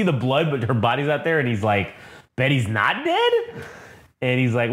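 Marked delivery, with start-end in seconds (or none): 2.4-3.3 brute voice climbing into a question; puzzled Hulk. Retold or imitated. Retold Hulk hearing surprising news.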